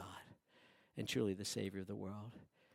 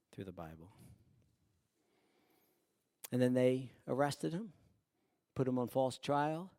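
ordinary talk, nothing marked

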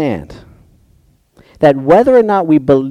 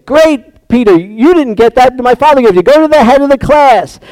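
higher, louder cheerful praise; Jesus praising Peter, raised voice; hearty praise; 6 semitones higher, 4 dB louder than usual. Retold or imitated. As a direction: congratulate him like a proud teacher.

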